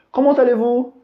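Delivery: teacher voicing a French speaker who greets you; asking voice. Shown as a demonstration. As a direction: play someone asking you. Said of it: "Se alguém perguntar pra gente".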